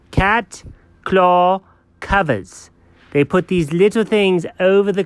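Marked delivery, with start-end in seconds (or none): 0.1-2.7 emphatic repeat. Pretend. Dog repeating it for a baffled friend, slow emphatic delivery. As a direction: repeat "cat claw covers" word by word, insistently. You heard me.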